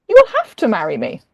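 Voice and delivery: loud, firm insistence; insistent demand. Acted. Woman insisting the duke must marry her, loud and firm. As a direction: push the demand hard and loud.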